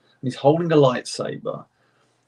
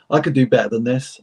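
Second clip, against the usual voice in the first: normal talking voice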